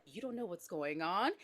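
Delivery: low, soft questioning; doubting husband. Acted. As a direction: quietly query your hysterical wife. Husband questioning her softly.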